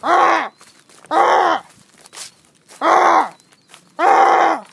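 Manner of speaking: loud, high honking goose call; loud imitated goose honks. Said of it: "It's a good goose call".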